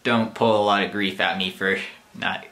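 ordinary delivery

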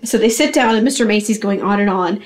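ordinary talk, nothing marked

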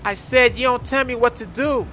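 defiant repeat. Acted. Repeats it when challenged with What you said.

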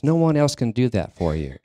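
ordinary delivery

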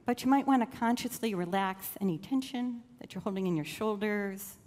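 normal talking voice

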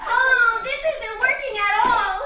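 loud, high, laughing frustration; exasperated laugh. Acted. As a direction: throw up your hands with a laugh.

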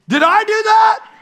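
high, slow innocent Did I do that; imitating son. Pastor imitates his son caught dragging the trash can, innocent. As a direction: son playing dumb, drawn out. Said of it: "he goes".

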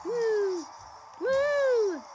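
high loud woo cheers; excited whooping. Real, not acted. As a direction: cheer like you're winning. Cheering while racing.

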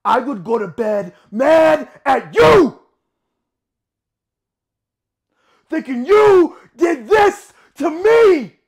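full-force angry shout, hammering the last words; loud, anguished accusing shout; furious accusation. Acted. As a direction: hurl the blame at the top of the voice.

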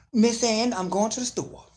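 high, loud proud elder announcing; hammed-up proud neighbour. Host mocking a proud elder announcing a trip to the store. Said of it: "I don't get it".

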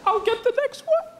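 high-pitched, sheepish little voice; sheepish offer. Acted. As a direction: offer it feebly after an awkward silence. Embarrassed offer after long hesitation.

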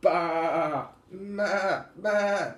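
loud, repeated drawn-out bleats; sheep bleating. Acted. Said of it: "A sheep says".

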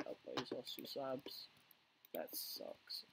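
normal talking voice

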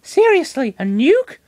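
high-pitched incredulous Pokemon voice; Lucario disbelieving. Acted. Lucario reacts to the nuke prediction, high-pitched disbelief. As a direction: squeak out your disbelief. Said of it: "Was all Lucario could say".

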